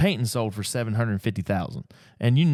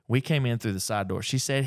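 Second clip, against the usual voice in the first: ordinary talk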